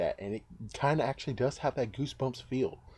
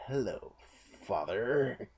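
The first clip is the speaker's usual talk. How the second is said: sinister Slappy greeting; 3 dB quieter, slower than usual. Pretend. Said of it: "I would prefer you rather actually have said".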